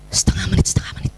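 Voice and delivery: urgent whisper to oneself; hushed, private reminder; self-reminder. Acted. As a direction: whisper the time limit under your breath. Reminding oneself of time limit.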